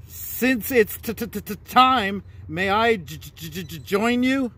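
high, stammering little squirrel voice; nervous squirrel with a stammer; stuttering squirrel. Acted. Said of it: "the squirrel replies".

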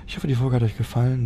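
ordinary delivery

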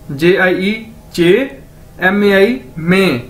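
spelled letter by letter, slowly: जे आई ई चे, एम ए आई मे; slow spelling out. Shown as a demonstration. Teacher recapping jiejie for the class, slowly. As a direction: spell each letter, then land on the syllable. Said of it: "क्या word".

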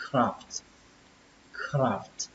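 "craft" with the voice dropping low; second-syllable "craft", falling and soft; dropped tone. Shown as a demonstration. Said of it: "âm thứ hai chúng ta sẽ xuống dòng".

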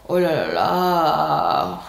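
slow, sighing oh la la la; exasperated oh la la. Shown as a demonstration. Showing the exasperated French oh la la. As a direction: drag it out, fed up. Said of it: "kind of like you're exasperated".

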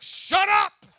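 high, very loud disbelieving yell; shocked shout. Retold or imitated. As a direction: blurt it out in total shock. Shocked outburst at friend.

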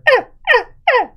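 repeated high, loud cartoon screams; hammed-up panicked squeals; mock yells. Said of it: "it'd be like".

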